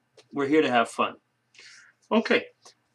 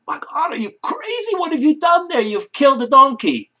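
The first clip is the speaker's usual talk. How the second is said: much higher and louder, aghast; shocked outburst; 11 dB louder than usual. Acted. Husband aghast at his wife shooting the donkey, shouting in shock. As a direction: yell it in horrified disbelief.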